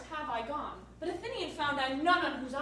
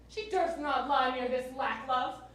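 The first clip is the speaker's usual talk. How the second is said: ordinary talk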